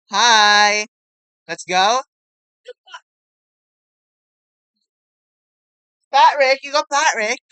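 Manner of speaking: high loud cheering; excited yell. Real, not acted. Kid cheering as Patrick shows up, yelling with excitement. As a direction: cheer it out at the top of the voice.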